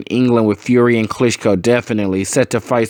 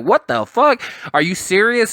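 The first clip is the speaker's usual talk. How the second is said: disbelief; 7 semitones higher than usual. Retold or imitated. Shocked reaction to teacher's offer.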